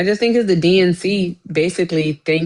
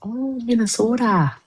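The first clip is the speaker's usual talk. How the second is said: put-on Minnesota accent; 6 semitones higher than usual. Co-host guessing where he's from, playful Minnesota accent.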